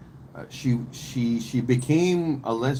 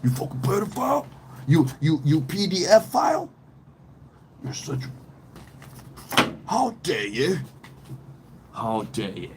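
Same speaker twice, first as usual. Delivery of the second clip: sneering, hissed then high jeer; hammed-up disgusted taunting; mock jeering rage; 3 semitones higher than usual. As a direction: taunt him like a disgusted inmate. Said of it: "you're gonna be like".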